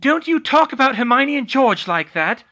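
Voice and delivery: high, very loud furious mother; mother shouting to defend Hermione; shouted. Acted. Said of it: "Molly shouted".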